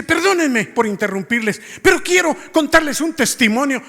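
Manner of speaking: high, loud interjection; insistent dad imitated. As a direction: act out an insistent interruption to the whole room. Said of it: "él insistió y dice".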